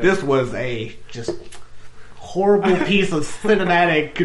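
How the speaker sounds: prim, fussy critic voice; primly disapproving film snob; prissy voice. Retold or imitated. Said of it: "your prissy voice".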